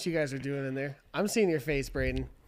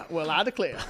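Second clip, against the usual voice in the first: lightly voiced genteel old southerner; Pipe Cottage voice. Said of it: "your Pipe Cottage impression".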